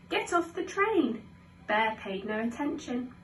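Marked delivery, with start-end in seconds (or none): 0.1-1.2 high-pitched storybook shout. A narrator's reading. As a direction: call out an order to the bear. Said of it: "Geoffrey shouted".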